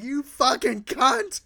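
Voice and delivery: drawn-out road-rage swearing; angry insult. Retold or imitated. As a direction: stretch out the insult, heated. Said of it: "I got so angry".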